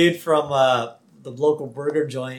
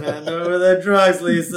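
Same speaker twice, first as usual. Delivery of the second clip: loud, put-on cartoon dad voice; raised mock fatherly demand; Homer; 3 semitones higher, 6 dB louder than usual. Father demanding the drugs from his daughter, loud imitated order. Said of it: "Homer walks over to Lisa and says".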